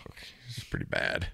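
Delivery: whispered aside, very soft; under my breath. Retold or imitated. Rookie whispering about a bad team speech, under his breath. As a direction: mutter it to yourself, unvoiced. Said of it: "I'm under my breath".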